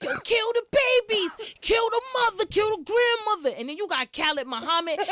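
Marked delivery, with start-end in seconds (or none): 0.0-3.6 high, loud fiery sermon voice; hammed-up fiery preacher; imitating Malcolm X. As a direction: preach destruction like a firebrand. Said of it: "And Malcolm X is like".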